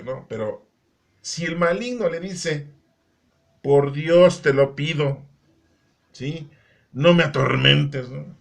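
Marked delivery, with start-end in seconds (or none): 3.6-8.1 loud, drawn-out imitated entreaty; hammered-out mock plea; acted plea. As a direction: plead forcefully and slowly, as a retold cry. Demon's plea to Jesus, acted.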